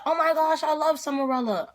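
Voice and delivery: high-pitched fangirl gush; imitated gushing friend. Best friend wanting to come along, high gushing.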